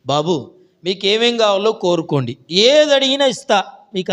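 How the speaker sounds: a king's open-handed offer, lightly voiced; hint of a generous king. King promises the three youths anything, grandly. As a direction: promise it big, rising high and loud.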